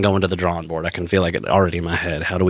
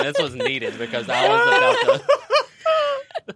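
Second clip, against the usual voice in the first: teary laughing; slower than usual. Real, not acted. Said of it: "I'm obviously in tears".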